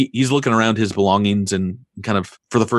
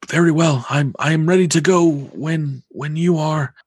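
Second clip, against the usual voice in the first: put-on higher old mystic voice; resigned old seer, ready to go; fortune teller; 6 semitones higher than usual. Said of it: "he says".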